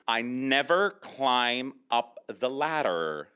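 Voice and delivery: very slow American model sentence; slow, exaggerated American r's; American pronunciation demo. Shown as a demonstration. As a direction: draw out each word in American for a learner. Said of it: "I say".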